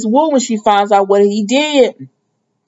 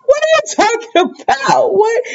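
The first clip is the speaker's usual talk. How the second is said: incredulous outburst. Real, not acted. Viewer reacting to Spencer.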